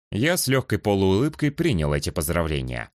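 normal talking voice

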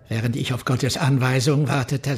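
ordinary delivery

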